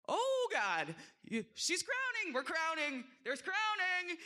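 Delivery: high, flustered cry of alarm; a panicked nurse blurting it out; startled nurse. Retold or imitated. Nurse lifts the gown and panics over the crowning baby, high and startled.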